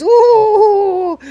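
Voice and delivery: long, loud, very high "ooh"; hammed-up indignant wail; offended wail. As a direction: howl in outrage after someone leaving. Machine wails as he leaves.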